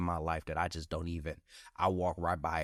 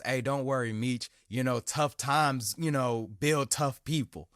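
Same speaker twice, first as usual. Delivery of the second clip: pep talk quote; 6 semitones higher, 5 dB louder, slower than usual. Retold or imitated. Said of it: "hit me with one of those".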